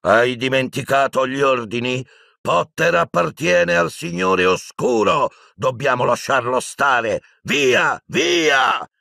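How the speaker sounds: high, slow, insistent shouting; yelled orders. A narrator's reading. Piton rebuking the Death Eaters, yelling. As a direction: drive the command home, rising in pitch. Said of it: "Piton urlava".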